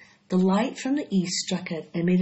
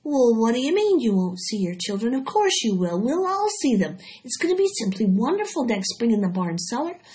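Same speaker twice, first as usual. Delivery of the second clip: put-on young pig Wilbur, eager; 6 semitones higher than usual. Wilbur tries to cheer up the sad spider, eagerly. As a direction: cheer her up with bright-eyed enthusiasm.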